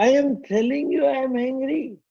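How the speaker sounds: high, fast, exasperated protest; hammed-up indignant outburst in a high voice; acted angry insistence. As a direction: insist angrily, pitch jumping right up. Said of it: "He'll say that with angry gesture".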